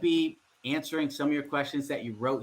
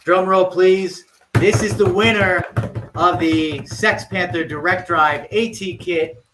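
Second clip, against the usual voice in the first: excited announcing; 3 semitones higher, 7 dB louder, slower than usual. Real, not acted. Drum roll winner announcement.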